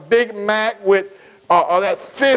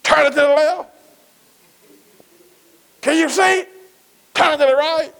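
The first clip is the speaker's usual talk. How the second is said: dad barking orders; 5 semitones higher than usual. Retold or imitated. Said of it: "when your dad said".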